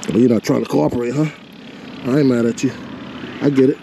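usual speaking voice

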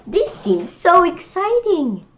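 excited. Acted. Reader hyping the book for children.

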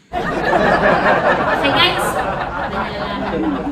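loud laughing sign-off to viewers. Real, not acted. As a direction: laugh through the words. (masayang tumatawa habang nagpapaalam sa mga manonood)

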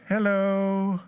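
high, drawn-out sing-song hello; cheeky angel greeting. Acted. Angel greets the women from atop the stone, cheeky sing-song. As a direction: hold the last note, high and level.

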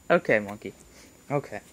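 usual speaking voice

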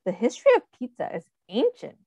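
ordinary delivery, nothing marked

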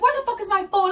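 high-pitched mock demand; imitated yelling. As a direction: imitate a friend frantic for the phone. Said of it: "this bitch fucking starts yelling at me".

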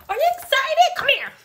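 talking to a puppy. Real, not acted.

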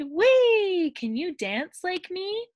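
high gleeful whee then a sing-song question; bright high frog call; excited frog cry. A narrator's reading. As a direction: squeal it out like a thrilled frog. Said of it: "Frog cried".

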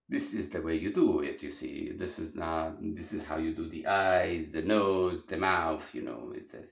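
lightly imitating an old Italian immigrant's English with an Italian accent. Art teacher imitating how Sir Pino's Italian accent sounds, light Italian accent. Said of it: "That's how the Italians actually used to talk".

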